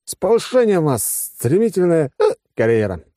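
high-pitched effusive praise with a hitch; tipsy congratulations. Acted. Tipsy officer congratulates his general on promotion, effusively. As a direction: congratulate grandly, fumbling for the word.

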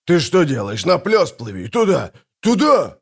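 urgent shouts climbing in pitch; yelling. A narrator's reading. Lookout correcting the captain's course, yelling. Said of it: "заорал Фиш".

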